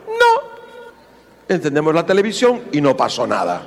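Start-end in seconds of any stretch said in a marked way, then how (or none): 0.1-0.5 high squeaky no. Retold or imitated. Curt reply.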